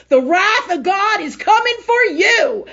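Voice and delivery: loud, high and slow, each word hammered; slow thundering threat; booming warning. Retold or imitated. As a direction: call down God's wrath on her. Retold confrontation climax.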